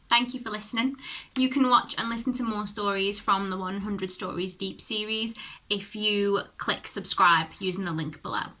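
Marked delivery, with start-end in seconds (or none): none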